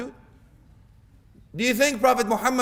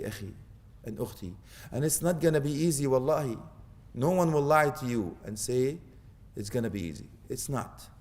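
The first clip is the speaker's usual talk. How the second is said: ordinary talk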